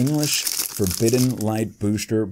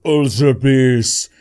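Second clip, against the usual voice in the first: hyped; 3 semitones higher, 5 dB louder, slower than usual. Real, not acted. Pulling an Ultra Beast.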